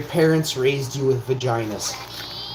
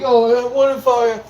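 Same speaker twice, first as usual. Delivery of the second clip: higher, louder wheedling "what if I"; mock sheepish asker; 7 semitones higher, 5 dB louder than usual. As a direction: play the hesitant moocher angling for a freebie. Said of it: "you'd be like".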